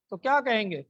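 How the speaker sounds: ordinary talk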